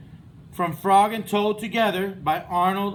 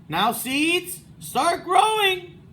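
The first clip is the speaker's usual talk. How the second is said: Toad calls out to seeds, drawn out; saying loudly; 10 semitones higher, 4 dB louder, slower than usual. A narrator's reading. Toad bends to ground, higher slow call. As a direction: say it louder and slower, higher. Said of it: "said loudly".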